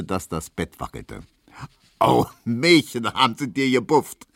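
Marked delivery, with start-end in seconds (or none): 2.0-4.3 amused roommate. Acted. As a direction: savour the joke. Neumann reacting laughing.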